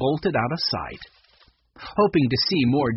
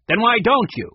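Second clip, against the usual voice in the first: higher, louder irritated retort; snapping; 9 semitones higher, 7 dB louder than usual. A narrator's reading. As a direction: snap it back at an intruder. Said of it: "she snapped".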